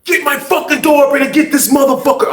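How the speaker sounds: high yelled demand; yelling. Retold or imitated. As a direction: holler it through the cell.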